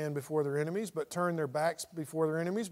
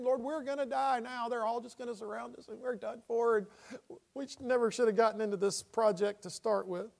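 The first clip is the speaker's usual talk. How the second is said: high, softer hand-wringing complaint; mock despairing whine; 4 semitones higher, 4 dB quieter than usual. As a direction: bemoan it in a raised, whiny pitch. Paraphrases Joshua's fearful whining prayer.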